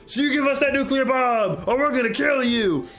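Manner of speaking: high, hammed-up dwarf shout; dwarven army. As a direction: call out a threat as a blustering dwarf. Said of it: "a dwarven army shows up and says".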